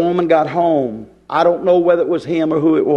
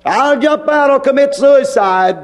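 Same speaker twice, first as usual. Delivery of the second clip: high-pitched hysterical threat, acted; mimicked screaming wife; 7 semitones higher than usual. Preacher mimics a wife in the car, screaming. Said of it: "she starts screaming".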